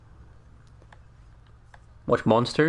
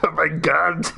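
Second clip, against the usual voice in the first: higher, overwrought action-star cry; Arnold Schwarzenegger; 5 semitones higher, slower than usual. Acted. Action star overcome in his new role, Austrian accent. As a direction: wail it in a mock Austrian accent. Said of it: "I was doing Arnold Schwarzenegger".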